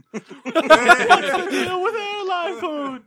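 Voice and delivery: high, slow, loud Seinfeld voice; Jerry Seinfeld impression. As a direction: stretch it out like Seinfeld. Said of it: "give me some Jerry Seinfeld".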